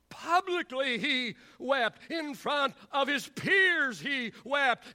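slow, emphatic sermon phrases, building; impassioned preaching. Acted. Preacher stresses that Jesus wept before his followers, fervent. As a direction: hammer each phrase to the congregation.

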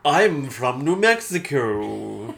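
very slow, drawn-out mock New Mexico accent; grandly elongated New Mexico accent. Shown as a demonstration. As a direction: stretch every word in a made-up New Mexico accent. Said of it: "Shot in the dark".

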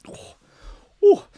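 ordinary talk

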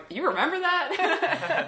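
parents recalling it, drawn out and amused; mock-incredulous parent asking, slow and laughing; imitating parents. Parents listening to the podcast, a slow, laughing, lightly voiced imitation. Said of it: "my parents are listening to this going".

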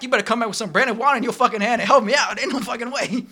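imitating a furious server; mock angry rant. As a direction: tell the manager off, playing it up. Retold rant at manager.

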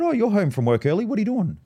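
lightly acted surprise, lifting the pitch; surprised mum. Mum finds her son home early from work, imitated surprise.

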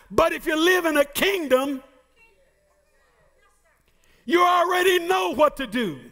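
emphatic preacher's cadence; preaching. Acted. Preacher contrasting democracy and kingdom for the church, emphatic preaching. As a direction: press the contrast on the congregation.

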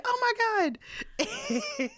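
high shocked gasp of a line; mock shock. Family reacts to the fall, high mock shock. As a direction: act out the family's alarm, high. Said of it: "we all looked like".

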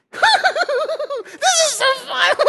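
very high, loud squeal of delight; childish glee. Pretend. Pastor pretending to be a kid in the kiddie pool, squealing. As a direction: squeal like a grown man turned toddler.

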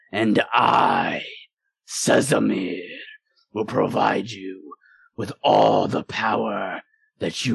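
slow, deep voice of a looming demon; a slow, menacing demon voice luring Rainey. Acted. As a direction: speak down from the fire as Sezamir, slow and heavy.

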